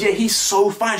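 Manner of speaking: high, insistent gush; mock girl doubling down. Mocking her doubling down on her crush, high gush. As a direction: say it again, no shame. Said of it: "She doubles down on it".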